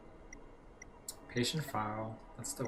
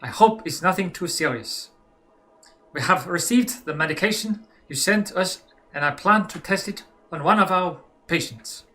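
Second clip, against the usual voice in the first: hammed-up German accent, louder letter reading; mock German accent, slightly raised pitch; 3 semitones higher, 5 dB louder than usual. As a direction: read the letter as a stiff German doctor in a German accent. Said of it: "let me do my best German".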